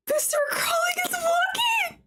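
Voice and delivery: near-crying excited yell; overjoyed shout. Real, not acted. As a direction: shout it in disbelieving delight. Companion walks for first time.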